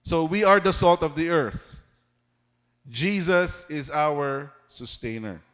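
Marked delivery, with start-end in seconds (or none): 2.9-5.4 slow, weighty statement of the sermon point; slow emphatic point. Shown as a demonstration. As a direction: lay it down slowly so it sinks in. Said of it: "That's our first point".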